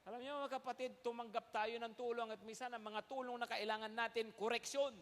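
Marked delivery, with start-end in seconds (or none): none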